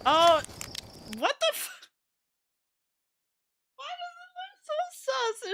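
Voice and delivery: incredulous squeak of suspicion; high disbelief. Real, not acted. Suspicious of video.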